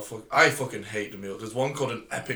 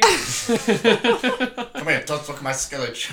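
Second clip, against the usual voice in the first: much higher and louder mock mobster on come here; noir gangster; 10 semitones higher, 5 dB louder, much slower than usual. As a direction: squeal it like a cartoon tough guy.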